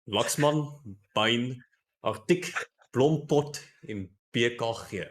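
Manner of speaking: names set apart in a strong Afrikaans accent. Shown as a demonstration. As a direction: read the roster in an Afrikaans accent. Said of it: "in an Afrikaans accent".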